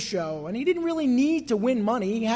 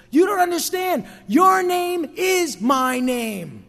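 furious raised shout, high and loud; father shouting at his son, slowing to drive it home; violently angry; 7 semitones higher, 5 dB louder, slower than usual. Retold or imitated. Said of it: "very violently angry and says".

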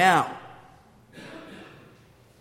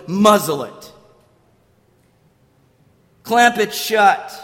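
loud, emphatic order; rebuking command; 7 dB louder, slower than usual. Acted. Jesus rebuking Satan behind the storm, firm loud command. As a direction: lay down the command with weight. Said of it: "Jesus rebukes the one who prowls".

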